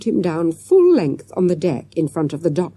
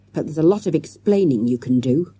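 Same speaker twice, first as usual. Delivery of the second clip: ordinary delivery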